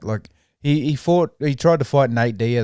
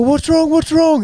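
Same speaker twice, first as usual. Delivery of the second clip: high, loud mock yell of what's wrong; 7 dB louder, slower than usual. Retelling the fighter's words at the weigh-in, high mock yell. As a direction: squawk it like the fighter did. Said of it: "He was like".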